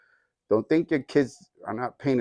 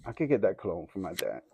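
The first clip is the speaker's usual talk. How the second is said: soft, low voicing of a private thought; inner thought; 6 semitones lower, 9 dB quieter than usual. Small boy planning to himself to get dad's cologne, quiet inner thought. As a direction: think it aloud quietly, low in pitch. Child's secret thought in story.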